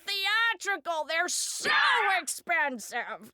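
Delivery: high, drawn-out mock dismay; Frank. Frank hearing the body shop choice, slow high mock dismay. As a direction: stretch it out in comic disapproval.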